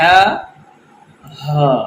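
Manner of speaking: ہا light, then ہا heavy; light and heavy ہا contrasted. Shown as a demonstration. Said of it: "تھوڑا سا بریک اور بھاری آواز میں".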